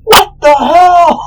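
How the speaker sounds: high, drawn-out howl, then indignant shout; hammed-up pained outcry; yelp of pain. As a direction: wail like your toe was just smashed. God's toe gets bonked.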